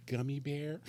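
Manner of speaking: put-on high girlish offer; lightly voiced young woman. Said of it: "The girl's like".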